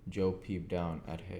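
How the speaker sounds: plain reading voice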